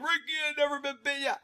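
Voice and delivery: high, quick put-on doubter's voice; mocking doubters. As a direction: mimic a naysayer in a high, hurried voice. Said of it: "I know you tell him".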